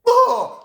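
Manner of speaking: high, loud cry of exasperation; sudden loud, high "oh!"; worked-up "oh". Real, not acted. Preacher worked up over Jeroboam, exasperated cry. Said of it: "I get worked up".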